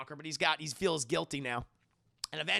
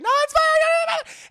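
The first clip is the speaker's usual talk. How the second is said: much higher, louder, strained voice about to cry; choked-up high squeak; 12 dB louder, much faster than usual. Retold or imitated. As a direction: squeeze it out before the tears come. Danny fleeing, about to cry.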